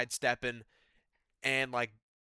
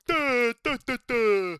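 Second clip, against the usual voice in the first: high loud dumb babbling; mock babble; 9 semitones higher, 8 dB louder, slower than usual. Mocking the commentators talking to each other, loud babble. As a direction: parody mindless chatter.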